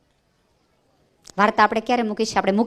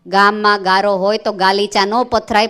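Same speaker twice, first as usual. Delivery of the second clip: ordinary talk, nothing marked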